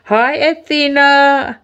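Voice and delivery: high, drawn-out happy hello; stretched-out, high-pitched hello; delighted greeting. Real, not acted. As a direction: greet an arriving friend in a high, slow singsong. Greeting a viewer who joins.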